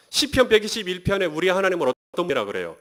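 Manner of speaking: ordinary talk, nothing marked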